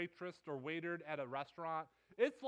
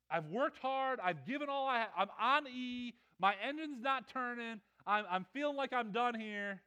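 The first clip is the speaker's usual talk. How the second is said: higher, louder run of complaints; frustrated; 4 semitones higher, 4 dB louder than usual. Retold or imitated. Exhausted Peter argues with Jesus, frustrated. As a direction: pile up the grievances, worn out and exasperated. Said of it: "I'm a little frustrated right now".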